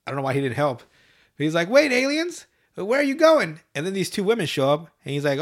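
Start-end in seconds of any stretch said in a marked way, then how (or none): 1.7-3.6 high, loud call after them; Chef calling out. Retold or imitated. Chef calling after the departing aliens, high. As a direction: call out to the leaving ship.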